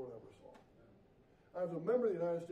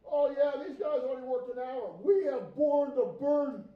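loud, resentful grumbling complaint; grumbling workers; 6 semitones higher, 10 dB louder than usual. Acted. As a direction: complain loudly like an aggrieved worker. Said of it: "They grumbled".

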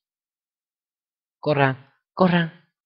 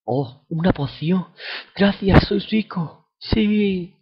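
mock delight; 6 semitones higher, much slower than usual. Gets a free potion.

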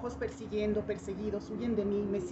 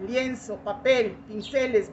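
usual speaking voice

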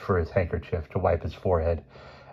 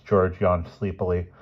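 ordinary delivery, nothing marked

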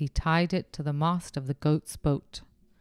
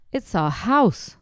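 slow, higher squeaky little mouse; 4 semitones higher, 5 dB louder, slower than usual. Acted. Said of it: "squeaked the mother mouse".

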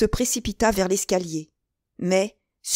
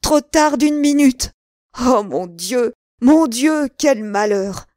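high, loud lament; despairing cry; 5 semitones higher, 6 dB louder, slower than usual. A narrator's reading. De Winter laments arriving late, crying out in despair. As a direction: wail over the disaster. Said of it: "s'écriait-il".

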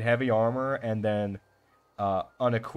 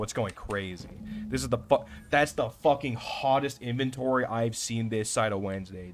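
ordinary delivery

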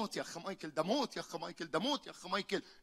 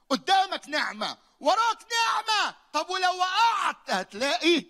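rising higher and louder, slowing as it peaks; building preaching climax; 7 semitones higher, 10 dB louder than usual. Acted. Preacher builds to a climax about grace, rising and loud. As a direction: build each phrase to a ringing peak.

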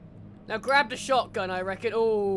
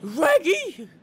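high, loud cartoon Scooby shout; Scooby-Doo impression; 7 semitones higher, 5 dB louder, much slower than usual. Gamer trying out Scooby-Doo for the viewers, loud cartoon voice. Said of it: "is that a good Scooby-Doo impression".

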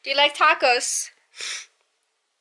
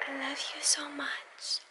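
whisper; 10 dB quieter than usual. Real, not acted. Whispered to sleeping baby.

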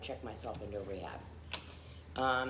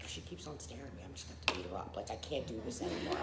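muttered threat; 5 semitones lower, 9 dB quieter, faster than usual. Retold or imitated. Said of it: "I'm like a raving lunatic".

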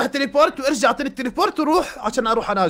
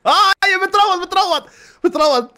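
high, louder burst of delight; excited; 9 semitones higher, 5 dB louder than usual. Real, not acted. The creature finally tames, excited cheer. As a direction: cheer as the taming works.

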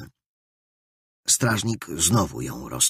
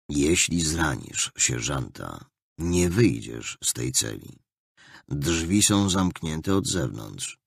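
lower, slow, cold commanding officer; stern captain; 6 semitones lower, slower than usual. A narrator's reading. The captain instructs the prisoner healer, low and stern. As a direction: lay down the rules flatly and coldly.

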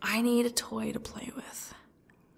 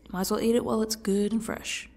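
usual speaking voice

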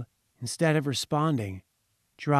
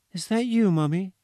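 put-on higher, small child asking, a touch anxious; a little girl unsure on the phone line; curious child's voice; 6 semitones higher, 3 dB louder than usual. A narrator's reading. Child on the phone to her mother, small and curious. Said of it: "slightly curious and anxious voice of a child".

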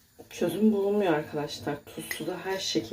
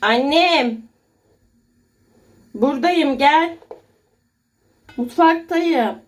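high, loud call to the next room; from another room; 9 semitones higher, 11 dB louder, slower than usual. Real, not acted. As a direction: call out to her son across the house. Calling son from the kitchen.

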